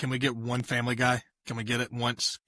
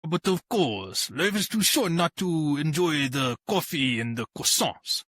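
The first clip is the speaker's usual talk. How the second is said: French accent; 4 semitones higher than usual. Shown as a demonstration. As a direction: practise the phrase in a hammed-up French accent. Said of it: "you need to do a French accent".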